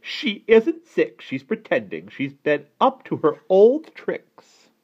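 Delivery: high, loud, emphatic accusation; angry shout. A narrator's reading. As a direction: stamp out the words as an outraged aunt. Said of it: "stamped her foot and shouted".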